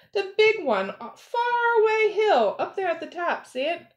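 high voice, easing down toward the end; high-pitched pointing out. A narrator's reading. Lion shows pupil the distant tree, high calling voice.

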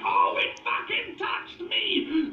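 high, drawn-out panicked yell; high-pitched comic panic; panicked shout. Acted. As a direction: shriek it out as if just grabbed. Iguana panics as snake touches it.

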